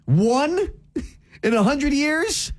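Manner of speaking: high, rising, scoffing disbelief; mock incredulous. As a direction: draw it out in high-pitched, indignant disbelief. Scoffing at co-host's one hurricane.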